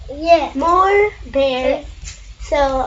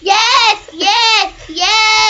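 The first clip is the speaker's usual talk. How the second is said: high, loud repeated yes; excited yeses; 7 semitones higher, 4 dB louder, much slower than usual. Real, not acted. Child cheering on the story bears, high and loud. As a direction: bounce out each yes.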